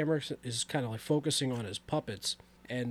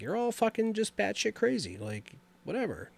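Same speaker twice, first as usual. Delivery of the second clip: dismissive; 8 semitones higher than usual. Retold or imitated. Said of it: "Doctor Sims is like".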